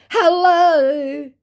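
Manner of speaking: high, loud sing-song hello; hammed-up chirpy hello; mock chirpy hello. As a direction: parody a fake celebrity greeting. Fake Brad Pitt greeting.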